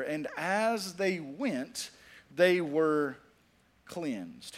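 each word slowed and weighed; slow emphasis. Shown as a demonstration. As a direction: lean on every word, slowly. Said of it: "I want you to see that last part there".

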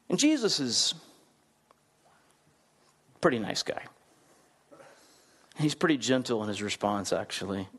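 ordinary talk

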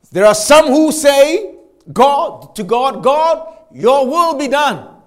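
preacher restating C S Lewis to the church; emphatic preaching. Acted. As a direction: make the contrast land hard. Preacher builds to climax.

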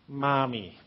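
put-on soft, low babyish Mommy; imitating Mommy. Grown bakery women calling their mom, in mild baby talk. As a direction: say it like a grown daughter cooing. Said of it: "you know what they call their mom".

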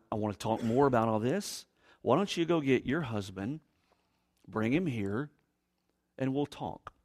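soft, low, unhurried invitation; hushed, calm coaxing; gentle. Acted. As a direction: ask it quietly, with pauses between steps. Paraphrasing Jesus's gentle request.

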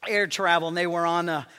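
ordinary delivery

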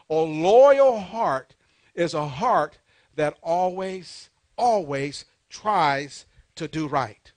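loud, drawn-out pulpit definition; slow emphatic preaching. Real, not acted. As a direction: stretch LOYAL and ALWAYS, word by word. Preacher hammers home a definition.

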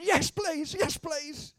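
high-pitched, pleading passenger voice; imitated nervous woman. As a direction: squeak it out like an anxious passenger. Voices nervous woman accepting prayer.

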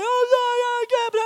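very high, loud mock cry; wailing daughter. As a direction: falsetto blubbered apology. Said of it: "and Tabitha".

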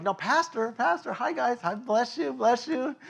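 high, nervous sing-song hello to the guards; mock cheery greeting. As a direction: chirp a nervous friendly hello. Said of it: "And I'm like".

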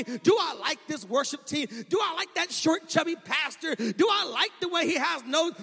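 loud, high, rising sermon delivery; preaching at full force. Acted. Pastor driving the congregation with full-force preaching. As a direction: preach it, pushing hard on every line.

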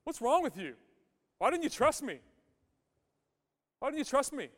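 hammed-up reproach; mock scolding of grieving friends. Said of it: "He didn't come and say".

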